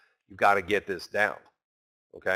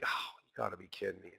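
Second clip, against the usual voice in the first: much softer, fast under-the-breath groan of disbelief; muttered disbelief; 5 semitones lower, 18 dB quieter, faster than usual. Retold or imitated. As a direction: mutter it quickly, like a trader who just got stopped out. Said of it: "you're like".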